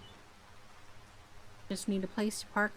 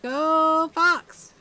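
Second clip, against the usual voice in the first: loud excited cheer; 8 semitones higher, 10 dB louder than usual. Real, not acted. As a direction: cheer the fox on. Cheering a fox in game.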